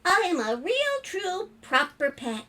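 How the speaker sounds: high little storybook spider voice; proud, happy spider. Acted. Spider finally accepted as a pet, proud and happy. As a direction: declare it with joyful pride.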